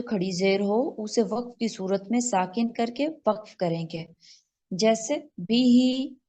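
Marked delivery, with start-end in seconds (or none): 4.7-6.2 बी ही spoken slowly, each syllable apart; slow recitation example. Shown as a demonstration. Teacher slowly spelling the example for her class. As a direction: drag out बी ही syllable by syllable. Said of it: "हा को साकिन करेंगे बिह".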